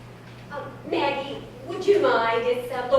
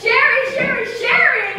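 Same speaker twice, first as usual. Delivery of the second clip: higher, louder calling out; excited calling; 7 semitones higher, 4 dB louder than usual. Acted. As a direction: call him over bursting with news.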